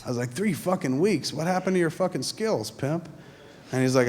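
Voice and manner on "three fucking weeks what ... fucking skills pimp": playful outrage at a buddy, pitched up; mock disbelief. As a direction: act stunned at a friend's dry spell. Mocking friend in disbelief.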